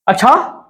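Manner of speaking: अच्छा rising at the end; questioning tune. Shown as a demonstration. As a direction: ask अच्छा as a question, pitch lifting. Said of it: "ये पूछ ले, अच्छा".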